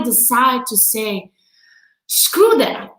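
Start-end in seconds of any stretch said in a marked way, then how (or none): 2.1-2.9 defiant. Retold or imitated. Voicing own defiant decision.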